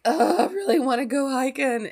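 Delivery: pining to get out on a trail; wistful self. Retold or imitated. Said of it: "I'm just like".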